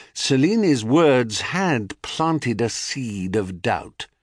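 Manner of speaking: plain reading voice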